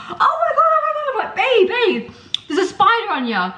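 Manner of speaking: high-pitched squeal of retold panic; hammed-up frantic warning with laughter creeping in; mock alarm. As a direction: squeak it out, urgent. Re-enacts warning boyfriend of spider.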